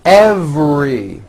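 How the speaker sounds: EVERY slowly, with the V held and buzzed; stretched V. Shown as a demonstration. Teacher modelling practice word, slow stretched EVERY. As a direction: linger on the V in EVERY. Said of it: "words with the V sound".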